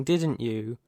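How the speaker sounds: usual speaking voice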